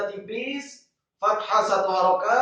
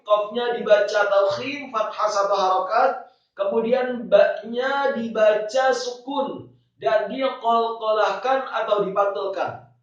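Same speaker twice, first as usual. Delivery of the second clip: ordinary delivery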